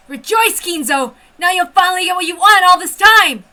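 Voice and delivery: high, loud sneering cry; mock yourself bitterly; shouted sarcastic rejoicing; bitter self-mockery.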